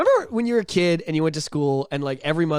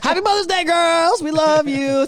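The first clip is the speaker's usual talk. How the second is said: gushing shout-out; 13 semitones higher, 6 dB louder, slower than usual. Acted. Cheery shout-out to moms.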